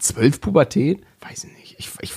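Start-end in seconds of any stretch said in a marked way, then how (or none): 1.2-2.2 whispered aside. Real, not acted. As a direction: whisper it to yourself. Aside, unvoiced.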